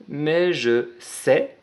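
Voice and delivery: drawn out word by word for writing; very slow dictation. Shown as a demonstration. Teacher spelling out the phrase for children, very slow. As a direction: stretch out mais je sais, very slowly. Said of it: "teacher dictating while writing".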